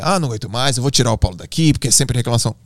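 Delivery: ordinary talk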